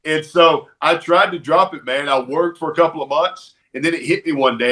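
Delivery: ordinary talk, nothing marked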